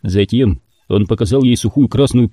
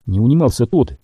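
ordinary delivery

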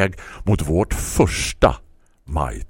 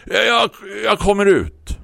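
calling out; 14 semitones higher than usual. Acted. Narrator calling to neighbour.

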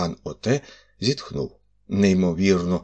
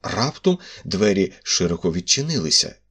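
ordinary delivery

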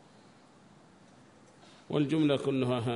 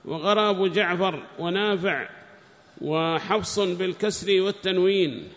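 high, loud, slow recitation of a text; declaimed scholarly reading; reading aloud; 7 semitones higher, 8 dB louder than usual. Acted. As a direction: read it out loudly and deliberately, pitched up. Reads the book aloud.

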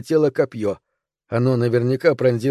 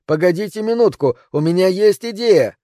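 higher, urgent appeal; shout; 6 semitones higher than usual. A narrator's reading. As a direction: call up to stall the attackers. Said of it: "крикнул я". (торопливо окликает, пытаясь остановить)

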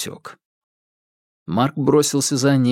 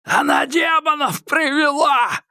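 much higher, slow shrieked accusation; crazed madman. A narrator's reading. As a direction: spit it out like a lunatic. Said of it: "воскликнул оборванец".

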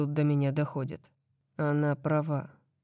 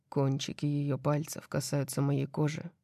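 ordinary delivery

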